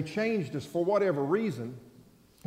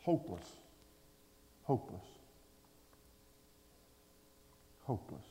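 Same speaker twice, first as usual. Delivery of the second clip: softer, dropping each time; quiet hopeless; 6 semitones lower, 14 dB quieter than usual. Acted. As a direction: let each hopeless fade away. Preacher repeats word.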